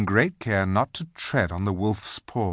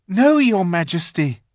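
put-on eager boy; 9 semitones higher, 7 dB louder than usual. Edmund answers witch.

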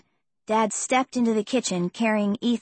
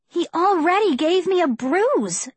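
protesting cry; 8 semitones higher, 3 dB louder than usual. A narrator's reading. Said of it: "I cried".

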